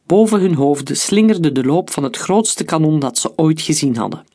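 ordinary delivery, nothing marked

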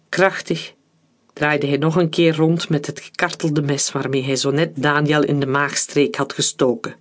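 plain reading voice

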